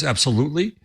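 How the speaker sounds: ordinary talk, nothing marked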